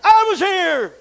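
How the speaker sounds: loud, high shout of protest; indignant shout. Retold or imitated. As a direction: yell at the queue-jumper. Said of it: "You say".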